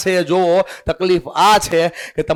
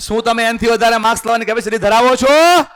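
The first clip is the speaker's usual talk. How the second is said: louder, higher and rising on છો; loud repeat; 5 semitones higher, 4 dB louder, faster than usual. Shown as a demonstration. Teacher repeats question to students, loud and rising. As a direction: throw the question out to wake the room. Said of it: "જવાબ આવે છે ને?".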